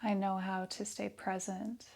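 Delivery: soft, hushed meditation voice with long pauses; guided meditation. Real, not acted. Yoga teacher leading breath awareness and affirmations, soft meditation voice. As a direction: guide them softly into stillness, leaving space after each phrase.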